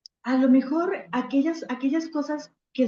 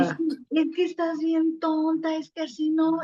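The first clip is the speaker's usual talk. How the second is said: higher, impatient mock scolding; exasperated friend scolding; 4 semitones higher than usual. Friend losing patience with you at the bar, higher and scolding. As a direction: play the fed-up friend, pitched up. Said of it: "tu amiga empieza a enojarse".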